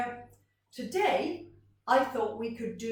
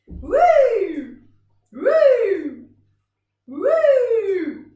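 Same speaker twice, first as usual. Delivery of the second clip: loud, high whoop, up and back down; swooping woo; 11 semitones higher, 14 dB louder, much slower than usual. Shown as a demonstration. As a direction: toss the sound up like a ball. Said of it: "let's see what this one sounds like".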